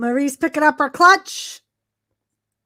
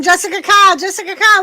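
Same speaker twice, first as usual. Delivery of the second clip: calling out; 5 semitones higher, 4 dB louder, much faster than usual. Real, not acted. Calling out to the referee.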